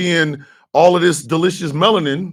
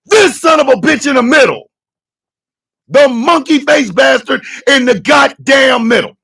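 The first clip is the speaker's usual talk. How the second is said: heated shout; 5 semitones higher, 3 dB louder than usual. Real, not acted.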